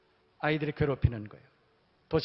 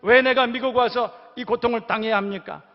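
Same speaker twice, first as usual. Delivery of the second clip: put-on loud, higher voice, a boy's anguished demand to God; demanding child's cry; 5 semitones higher, 8 dB louder than usual. Boy on the toilet demanding answers from God, anguished loud protest. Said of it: "따지듯이 물었다는 거예요".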